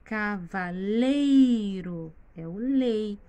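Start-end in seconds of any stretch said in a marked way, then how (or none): 0.0-2.1 cavaleiro slowly split as ca-va-LEI-ro, LEI held long; slow cavaleiro with a swelling third syllable; stress on LEI. Shown as a demonstration. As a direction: stretch and lean hard on LEI in cavaleiro. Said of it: "que é o mais forte".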